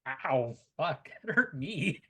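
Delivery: loud, high yelp breaking into laughter; laughing, mock-wounded reaction. Real, not acted. Game master stung by the player's cutting line, laughing yelp.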